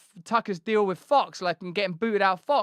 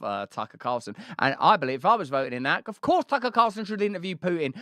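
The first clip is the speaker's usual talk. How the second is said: ordinary talk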